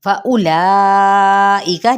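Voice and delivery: slow chanted फउलाइका with the ला stretched; tilawat-style फउलाइका; recited word. Shown as a demonstration. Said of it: "जल्दी से पढ़ते हुए ला को".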